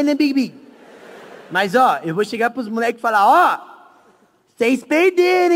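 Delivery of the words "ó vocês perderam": slow, high, louder gloating; mock taunt. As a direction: rub it in with a drawn-out call. Repete a provocação encenada. (provocação encenada, lenta e aguda)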